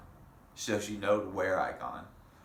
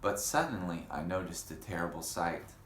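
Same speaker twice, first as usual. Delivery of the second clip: ordinary delivery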